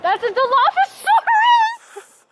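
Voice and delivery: high, slow screeching dinosaur. Pretend. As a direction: shriek it like a Dilophosaurus. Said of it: "Is that your dinosaur impression?".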